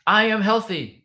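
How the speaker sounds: loud and high-pitched; high-energy demonstration yell; yelled affirmation. Shown as a demonstration. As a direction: shout it out like a rallying chant.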